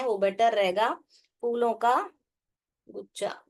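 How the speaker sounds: normal talking voice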